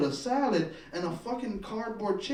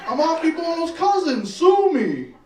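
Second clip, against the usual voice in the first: defiant shout; 7 semitones higher, 11 dB louder than usual. Retold or imitated. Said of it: "I'm like".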